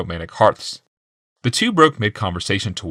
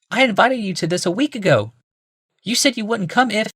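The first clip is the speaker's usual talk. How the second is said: much higher female voice; woman's voice; 13 semitones higher than usual. A narrator's reading. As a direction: speak as a woman, high.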